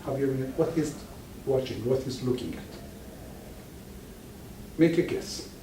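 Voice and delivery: ordinary talk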